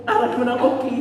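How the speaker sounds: usual speaking voice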